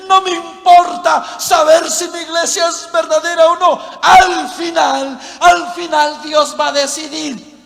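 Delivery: mock an indifferent believer out loud; loud dismissive retort. Preacher imitates people who don't care about the true church, loud dismissive retort.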